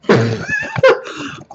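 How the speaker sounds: high-pitched burst of laughter; real laugh. Real, not acted. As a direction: break up mid-recording. Breaks up after a slip.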